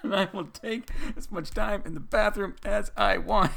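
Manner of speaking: mock dad voice. Dad defending his time in the bathroom, hammed up. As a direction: lay down the law comically.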